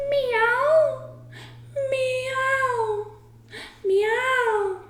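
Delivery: high, drawn-out meows; high plaintive meowing; cat crying. A narrator's reading. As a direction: mew like a sad cat. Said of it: "they heard another cry".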